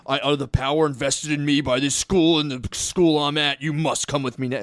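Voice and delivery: mock-formal decree, lightly voiced; mocking a school official who summons a kid by authority; pompous official parody. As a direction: puff up like a self-important administrator.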